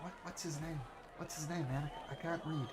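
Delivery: hushed urgent whisper; quick whisper. Acted. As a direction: whisper it fast so the crowd can't hear. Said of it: "and he whispers quickly".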